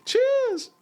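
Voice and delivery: very high squeaky cheers. Pretend. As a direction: squeak it out playfully.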